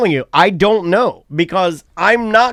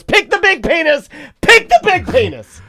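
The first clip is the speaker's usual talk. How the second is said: high-pitched mock yell of a daughter; high, gleeful retold shouting, climbing on the repeat; imitated child's scream; 12 semitones higher than usual. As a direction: shriek it like an excited kid, hammed up. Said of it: "She screams. This is a quote".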